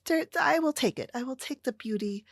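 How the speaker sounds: ordinary talk, nothing marked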